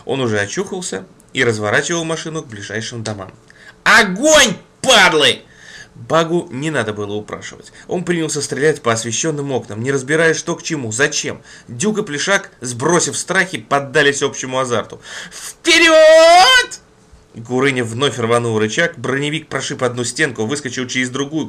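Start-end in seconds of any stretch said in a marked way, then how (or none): 3.8-5.4 loud order to fire; shouted command. Acted. 15.3-16.8 high loud battle cry; shouted command. Acted. Leader orders charge.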